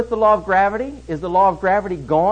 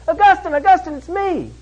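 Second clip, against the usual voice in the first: much higher, put-on woman's voice; insistent calling out; 12 semitones higher, 4 dB louder than usual. Old girlfriend calls after Augustine as he hurries off, insistent high call. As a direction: imitate her calling louder after someone walking away.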